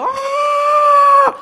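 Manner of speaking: chillido muy agudo y fuerte; un "¡Oh!" eufórico de mujer; grito de alegría imitado. Retold or imitated. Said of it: "y me busca". (la mujer lo ve y chilla de emoción)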